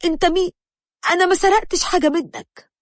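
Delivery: high-pitched frightened protest; terrified. A narrator's reading. Kidnapped woman denying theft to her captor, terrified. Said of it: "قلت له برعب".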